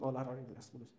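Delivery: a drowsy murmured chant fading to nothing; sleepy murmur. Shown as a demonstration. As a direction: mumble the rhyme as if dropping off to sleep. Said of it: "ঘুমাইতে গেলেও এগুলা practice করবা".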